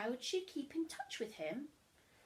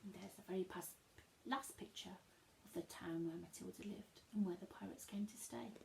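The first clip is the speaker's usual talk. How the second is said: whispered; 4 semitones lower, 9 dB quieter, faster than usual. Real, not acted. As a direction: whisper it gently. Soft aside.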